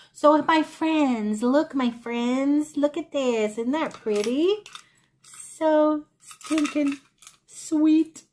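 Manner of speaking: high, slow, drawn-out cooing; gushing admiration. Real, not acted. Showing viewers her pretty find, gushing slowly and high. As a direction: show it off slowly, savoring each word.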